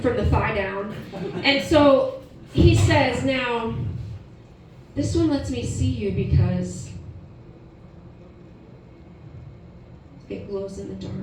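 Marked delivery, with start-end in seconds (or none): 3.2-11.1 halting, with long pauses, sinking low and soft; mild husband reveal. Retold or imitated. Husband outside bathroom revealing the outfit, lightly hushed suspense. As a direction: hold back the reveal, then drop it quietly.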